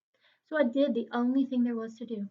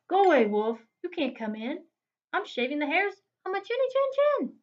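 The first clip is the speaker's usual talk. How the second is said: loud shout that climbs higher; pig yelling back; 5 semitones higher, 7 dB louder than usual. Acted. Second pig yelling at the wolf through the door. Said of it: "He yelled back".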